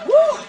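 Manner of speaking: high, loud "woo"; celebratory whoop. Acted. Speaker celebrates getting her applause, high loud whoop. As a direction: let out a quick high cheer. Cheer after asking for applause.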